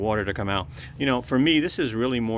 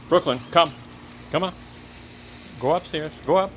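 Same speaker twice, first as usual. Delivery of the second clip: calling the dog; 6 semitones higher, faster than usual. Real, not acted. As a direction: call the dog over.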